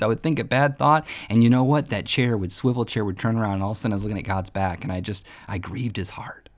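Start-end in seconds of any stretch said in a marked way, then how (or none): 1.9-6.5 sinking into a whisper; hushed. Retold or imitated. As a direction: drop to a whisper, fast then hushed. Grief at God's back.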